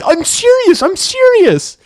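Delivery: very high insisting "serious" twice; mock pleading roommate. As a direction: whine it out like he means it. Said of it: "He's like".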